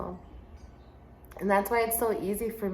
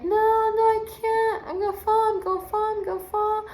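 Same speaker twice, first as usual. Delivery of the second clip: put-on scared crying child; 14 semitones higher, 3 dB louder than usual. Boy on the rock wall pleading with his teacher, high frightened child voice. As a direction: play the frightened boy sure he'll fall. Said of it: "he starts crying".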